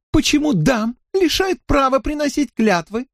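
very high, coquettish protest; high playful lady. Acted. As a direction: tease the lords for fairness. (кокетливый высокий голосок)

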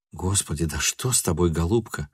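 soft, low-voiced puzzlement; bewildered question. A narrator's reading. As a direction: ask her softly, at a loss. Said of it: "спросил он недоуменно".

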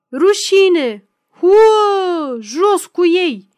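loud, high, drawn-out jeering; mob howl. A narrator's reading. Mob rushing at the gendarmes' cordon, howling jeers. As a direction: boo and shout them down. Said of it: "urlă gloata".

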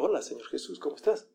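soft, polite mock greeting; saludo amistoso. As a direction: greet him gently, as a contrast to shouting. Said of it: "no lo llegan saludando". (saludo suave y cortés)

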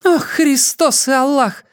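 high, slow shocked exclamation; exclaiming. Acted. Dayana learning he is a cybrid, shocked cry. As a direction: gasp it out in shock. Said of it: "воскликнула леди Даяна".

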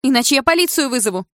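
high, loud mother's warning; shouted threat. A narrator's reading. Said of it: "закричала мама". (крик с угрозой)

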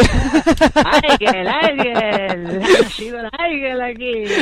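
high, loud hero fanfare shout; superhero announcer. Acted. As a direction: herald the birth of a heroine. Hailing a new superhero. (anuncio épico a gritos)

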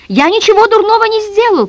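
put-on high, loud boy's protest; shrieked protest, held high; crying out. A narrator's reading. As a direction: yell it in a high child's voice. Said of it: "крикнул Митя".

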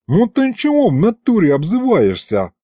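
high-pitched street-tough protest; offended demon. A narrator's reading. Demon protests being called a pig, offended. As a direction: object to the insult. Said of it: "нахмурился Аристофан".

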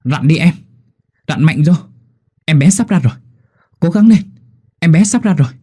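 loud, high coaching cries; urgent encouragement. A narrator's reading. Midwife urging the mother to push, loud and high. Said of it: "Cô Hai Lành kêu lên".

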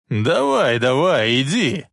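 mocking shout after her. A narrator's reading. Biff calling after departing Lorraine, dismissive shout. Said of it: "крикнул ей вслед Биф".

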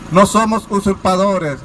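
usual speaking voice

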